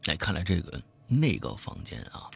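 usual speaking voice

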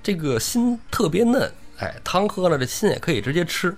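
a hint of a gleeful bandit cook. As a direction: relish the grisly recipe, mock cheerful. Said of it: "边跟这个屋里的大王说".

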